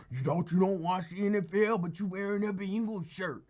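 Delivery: high, loud mock heckler; accusing hater. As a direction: imitate a critic calling you out. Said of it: "so before anybody".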